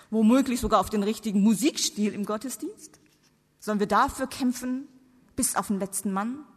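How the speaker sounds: ordinary talk, nothing marked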